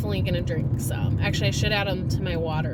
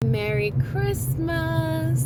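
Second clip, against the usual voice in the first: sing-song greeting; 8 semitones higher, much slower than usual. Real, not acted. Greeting, laughing.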